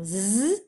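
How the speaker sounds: "Z" as a held buzzing sound; isolated Z sound. Shown as a demonstration. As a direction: sound out "Z", don't name the letter. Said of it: "j'entends le son". (« Z » prononcé comme un son, zzz)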